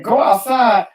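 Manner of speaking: high-pitched incredulous echo; indignant protest. Retold or imitated. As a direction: retell an outraged question back at mom. Retold protest to mother.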